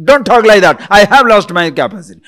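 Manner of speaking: loud, fast, hammed-up outburst; angry protest. Person provoked by a doubter, angrily loud. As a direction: snap back indignantly while claiming calm. Acted denier exploding at the doubter.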